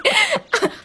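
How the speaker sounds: high burst of laughter; delighted laugh. Acted. Delighted by the story.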